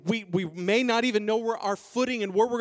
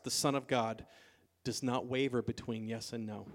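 soft, low, measured sermon voice; hushed preaching; 5 semitones lower, 7 dB quieter than usual. Real, not acted. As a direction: explain it quietly and earnestly to the congregation.

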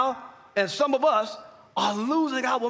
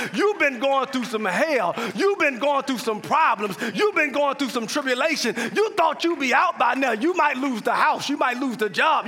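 normal talking voice

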